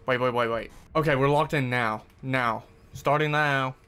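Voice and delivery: normal talking voice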